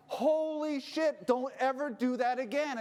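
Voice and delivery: slow, high, loud angry snap; angry outburst. Retold or imitated. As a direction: drag out the words in angry disbelief. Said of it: "And I'm getting angry".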